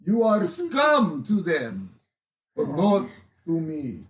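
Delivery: put-on lightly done Russian accent speech; rally the crowd in a Russian accent. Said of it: "I have a Russian accent".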